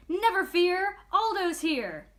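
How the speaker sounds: loud, high hero's roar. A narrator's reading. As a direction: burst in like a cheerful superhero. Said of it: "a familiar voice roared".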